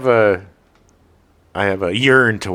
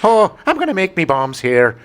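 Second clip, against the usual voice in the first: hammed-up Irish accent, pitched up; mock Irish brogue in a raised voice; bad Irish accent; 7 semitones higher than usual. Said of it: "with a really bad Irish accent".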